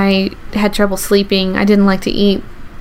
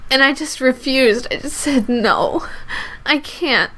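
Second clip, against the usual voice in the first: tearful; 5 semitones higher, 3 dB quieter than usual. Real, not acted. Retelling her mother's answer and her own plea, tearful. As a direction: voice held high and tight with tears. Recounting refusal, still upset.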